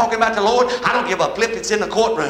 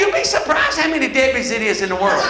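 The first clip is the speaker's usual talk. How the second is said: ordinary delivery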